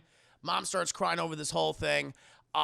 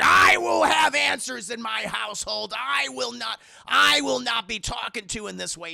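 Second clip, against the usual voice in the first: loud, raised, booming declaration; mock patriarchal fury; 4 semitones higher, 8 dB louder than usual. Dad demands answers in his household, angry shout. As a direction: lay down the law at full voice.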